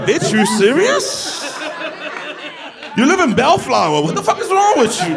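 high, laughing incredulous jab; laughing mock scold. Crowd mocking the woman from Bellflower, laughing disbelief. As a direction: tease her through laughter.

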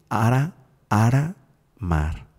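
"Ara, ara" slow and drawn out, then "mar" set apart; syllable by syllable. Shown as a demonstration. Teacher sounding out the syllables of a name, "Ara" and "mar" slowly separated. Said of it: "tienes en tus sílabas".

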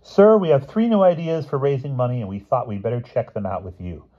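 high, loud opening, settling down; keen boy addressing sir; eager schoolboy. A narrator's reading. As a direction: pitch an idea to the headmaster, starting high.

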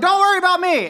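high-pitched mock yell back; retold yelling. [name] answers nosy party next balcony, retold yelling. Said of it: "then I started yelling".

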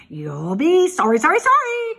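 loud goose call climbing high; loud, escalating goose taunt; goose calling warning. A narrator's reading. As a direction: call after the pig, rising to a squawk. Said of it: "called the goose".

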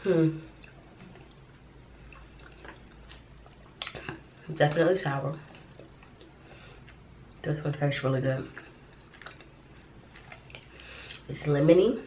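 talking through chewing; chewing while talking. Real, not acted. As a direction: mouth partly full. Seen 0: Gummy placed at mouth at -12; pursed chewing lips throughout labelled words.

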